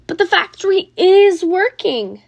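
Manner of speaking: high, loud insistent shout. A narrator's reading. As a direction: shout it across the crowd. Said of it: "the people shouted".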